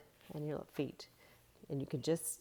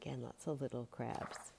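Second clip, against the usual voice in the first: much softer, hushed; quiet; 5 semitones lower, 10 dB quieter than usual. Shown as a demonstration. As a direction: point them out in a hush. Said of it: "hushed pointing out crabs".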